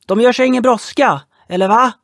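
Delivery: high, loud, taunting call; called across. Acted. Boy on the boat calling over to the men. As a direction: shout it over to the other boat. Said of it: "ropade pojken på renen".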